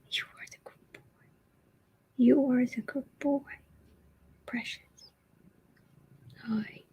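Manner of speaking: hushed doting murmur to a pet; whispered pet talk. Real, not acted. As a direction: coo to the cat under your breath. Whispering baby talk to cat.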